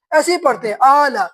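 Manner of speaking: usual speaking voice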